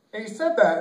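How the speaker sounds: usual speaking voice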